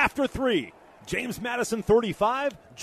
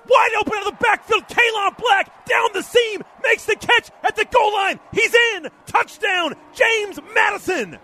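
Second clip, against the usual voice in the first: excited; 10 semitones higher, 5 dB louder than usual. Real, not acted. Touchdown call.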